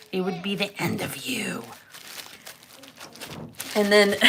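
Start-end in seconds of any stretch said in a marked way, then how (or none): 0.1-1.6 soft, whispery mock threat; hushed threat. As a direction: drop to a menacing near-whisper. Mother's threat to her son.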